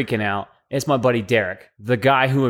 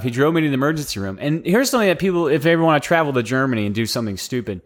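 ordinary talk

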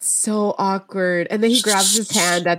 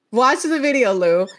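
usual speaking voice

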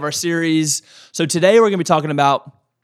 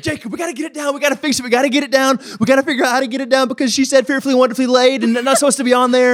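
high-pitched, fast, frantic retelling; panicked; 8 semitones higher, faster than usual. Retold or imitated. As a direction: reenact a panicked phone call, words tumbling out high and fast. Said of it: "I started panicking and called Jacob".

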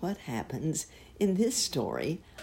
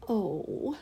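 sad oh; 6 semitones higher than usual. Acted. Cow sadly answering Uncle Wiggily. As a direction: sigh it out sadly. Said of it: "was the sad answer".

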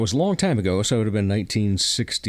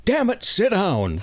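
lightly voiced father, pitched up and drawn out; mock-exasperated dad telling off a small child; slow, higher-pitched mock scolding; 10 semitones higher, slower than usual. Father's scolding acted in a story.

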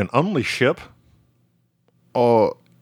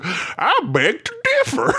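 high, hammed-up British retort; exaggerated British objection through laughter; mock British accent. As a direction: protest in a put-on British accent, pitched way up.

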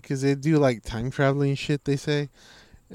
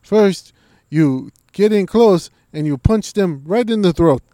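loud fight tips; 6 semitones higher, 9 dB louder than usual. Retold or imitated. GSP listing punches, loud and high. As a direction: boom out the combo steps. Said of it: "It's like".

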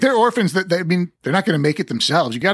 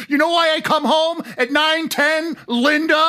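mock drunk dad; 11 semitones higher than usual. Voicing the late drunk daddy.

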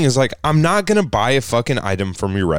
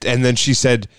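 usual speaking voice

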